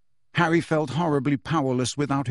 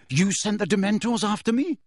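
higher, incredulous question; shocked gasp; 7 semitones higher than usual. A narrator's reading. Boy confronts his attacker, gasping mildly. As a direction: ask it in disbelief, pitch rising. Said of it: "gasped Harry".

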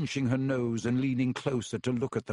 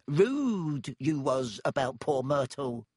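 sly poltergeist voice; slower than usual. Acted. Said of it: "his eyes dancing".

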